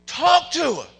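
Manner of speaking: high, shouted revival preaching; roaring exhortation to the congregation; full-force preaching. Real, not acted. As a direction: drive the lesson home, slow and very loud.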